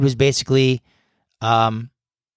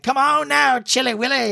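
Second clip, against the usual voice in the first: high goofy voice; 12 semitones higher than usual. Retold or imitated. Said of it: "and he'd be like".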